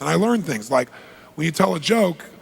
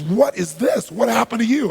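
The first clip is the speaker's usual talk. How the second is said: appalled questioning, pitched up; retold shock; 8 semitones higher, 4 dB louder than usual. Businesswoman spots his bitten fingers, retold shock. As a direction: demand an answer in mock shock.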